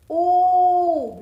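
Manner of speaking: Ooh held long and loud; long oo sound. Shown as a demonstration. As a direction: draw out the Ooh. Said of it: "Long sound".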